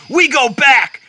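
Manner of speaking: firm narrated outburst; demanding. A narrator's reading. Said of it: "Bruce demanding".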